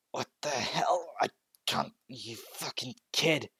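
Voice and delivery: slow whispered strain, fighting the command; strained villain. Acted. Shigaraki forced to bow, slow strained whisper. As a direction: hiss it out through the struggle.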